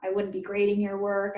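ordinary talk

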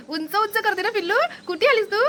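very high cooing at the little bird. Real, not acted. Cooing directly to the pet bird.

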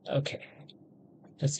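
whispered okay to self; a private whispered okay. Real, not acted. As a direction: breathe it under your breath. Quiet aside while playing game.

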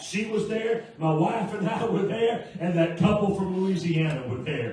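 loud, impassioned pulpit delivery; full-force preaching. Real, not acted. Preacher testifies to the congregation how God provides, loud fervent preaching. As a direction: preach it out loud, driving toward each amen.

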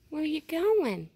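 calling out. Real, not acted. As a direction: call out as it runs away. Animal leaving, calling after it.

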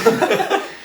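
light breathy giggle; real laugh. Real, not acted. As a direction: laugh at yourself, high and airy. Laughing at forgetting the lyrics.

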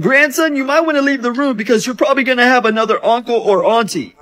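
put-on high, fast old lady warning; grandma warning her grandson. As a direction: talk like a sly grandma. Grandma voiced in skit.

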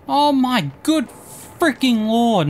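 normal talking voice

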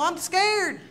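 high, loud panicked outburst with a laugh; scared. Retold or imitated. To his wife in the elevator, loud laughing panic. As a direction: blurt it out like a terrified man laughing at himself. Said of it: "I go".

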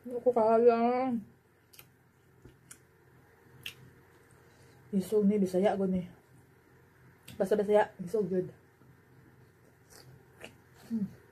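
talking with mouth full; speaking between bites; chewing noodles. Real, not acted. Seen 1: Cheeks bulge from chewing throughout; noodles enter mouth at 7.1–8.1.

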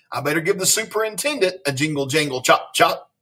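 lively read-aloud with playful bounce on silly words; animated picture-book reading; story narration. A narrator's reading. Reading a silly school story to children, animated storyteller.

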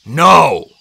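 firm, forceful refusal; insistent. A narrator's reading. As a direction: refuse firmly and loudly. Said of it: "Chiron insisted".

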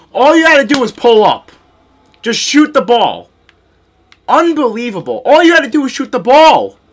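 frustrated yelling. Real, not acted. Frustrated with Paul George.

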